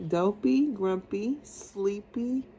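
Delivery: usual speaking voice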